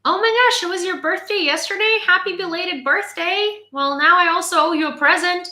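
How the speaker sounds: high, loud real surprise; excited surprise. Real, not acted. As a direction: exclaim at sudden good news. Surprised to learn of birthday.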